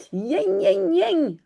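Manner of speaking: high mock yelps of a hurt puppy; dog whimper. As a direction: whimper like a scratched little dog. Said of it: "huilt Taki". (gémissement aigu de chiot blessé)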